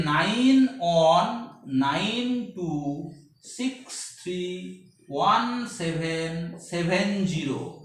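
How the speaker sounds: very slow, spaced-out digits; deliberate digit-by-digit delivery for memorising; slow dictation. Real, not acted. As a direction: read out each digit with gaps between. Teacher dictates a long number.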